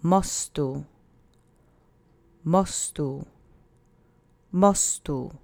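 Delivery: "Mostu" stressed on the first syllable, MO-stu; Polish demo word. Shown as a demonstration. Teacher modelling mostu for repetition, stress on the first syllable. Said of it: "Accent is on the first syllable".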